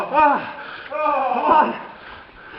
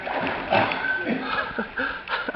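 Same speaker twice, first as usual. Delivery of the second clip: ordinary talk, nothing marked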